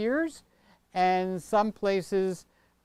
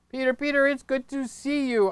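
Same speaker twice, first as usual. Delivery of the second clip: a touch higher, eager greeting; lightly voiced eager viewer; 7 semitones higher than usual. Reading a viewer's eager greeting, lightly voiced. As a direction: read it like an excited friend.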